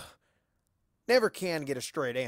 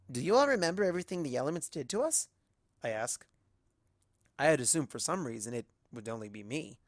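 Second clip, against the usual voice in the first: ordinary delivery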